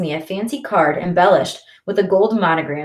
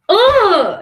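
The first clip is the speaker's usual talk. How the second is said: frustrated grunt; 14 semitones higher, 8 dB louder than usual. A narrator's reading. Kicking bookcase in frustration.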